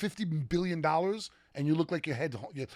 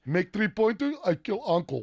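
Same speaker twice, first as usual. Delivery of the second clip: louder, higher mock Eastern European accent; Eastern European mobster; 5 semitones higher, 5 dB louder than usual. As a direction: bark threats as a cartoon Serbian mafioso with a thick accent. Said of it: "the Eastern European stare".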